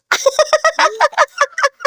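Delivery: long, high-pitched giggle; high giggling. Real, not acted. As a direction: crack up in a high staccato giggle. Cracking up at the child. (matinis at tuloy-tuloy na hagikhik)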